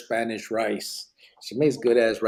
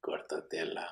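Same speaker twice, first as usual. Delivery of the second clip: whispering; 8 semitones lower, 15 dB quieter than usual. Real, not acted. Quiet aside after swearing.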